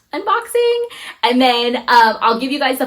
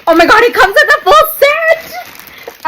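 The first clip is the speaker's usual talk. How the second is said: much higher, louder, slowed, laughing with delight; excited squeal; 8 dB louder than usual. Real, not acted. Vlogger discovering the full set, squealing with delight. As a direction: gush with real delight over the contents.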